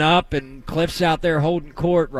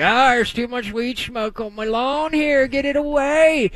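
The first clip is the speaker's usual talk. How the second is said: uptight neighbor; 6 semitones higher than usual. Retold or imitated. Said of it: "uptight people were like".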